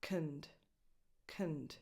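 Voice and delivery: "Knd" low and soft with a reduced vowel; unstressed, softened "knd"; schwa syllable. Shown as a demonstration. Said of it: "is just a schwa".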